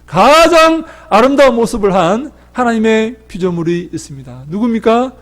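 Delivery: high and loud, building fervour; preaching at full force. Acted. Pastor rousing the congregation about God's creation, fervent preaching. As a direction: preach it out to the whole hall.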